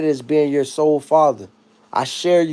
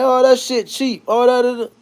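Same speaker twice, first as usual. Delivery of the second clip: mock clowning; 6 semitones higher than usual. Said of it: "people be clowning them like".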